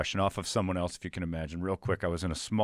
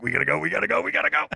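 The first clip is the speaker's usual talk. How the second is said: panicked urge; 7 semitones higher, 5 dB louder, much faster than usual. Retold or imitated. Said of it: "I'm like".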